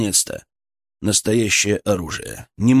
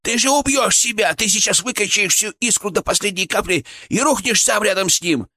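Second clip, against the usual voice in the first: Fyrk shouting; 9 semitones higher than usual. A narrator's reading. Fyrk warning his partner he'll kill himself, high and frantic. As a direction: plead urgently in a high squeaky voice. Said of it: "кричал Фырк в моей голове".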